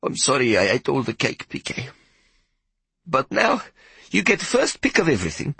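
put-on a big woman saying sorry to the boy; voiced Hetty.

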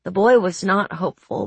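ordinary delivery, nothing marked

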